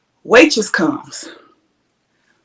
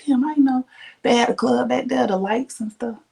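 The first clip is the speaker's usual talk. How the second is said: whisper; 4 semitones higher, 6 dB quieter than usual. Retold or imitated. Sneaking a remark to her date.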